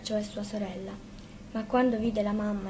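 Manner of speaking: usual speaking voice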